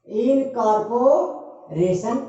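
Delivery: Incorporation slow, drawn out in separated chunks; broken into syllables. Shown as a demonstration. Teacher showing five syllables, incorporation split up slowly. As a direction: stretch incorporation with a gap before the ending. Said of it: "इसमें पांच syllable है".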